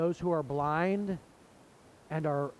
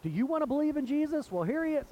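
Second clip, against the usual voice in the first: the healed blind man retorting to the leaders, higher and faster; exasperated retort; 10 semitones higher, 3 dB louder, faster than usual. Retold or imitated. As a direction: pitch jumps way up, fast and incredulous. Healed man challenges religious leaders.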